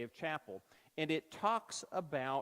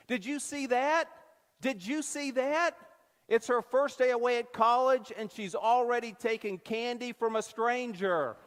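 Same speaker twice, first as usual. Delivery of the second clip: much higher and louder, calling out; really loud voice; 7 semitones higher, 8 dB louder than usual. Retold or imitated. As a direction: announce it to the whole line with glee. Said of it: "said in a really loud voice".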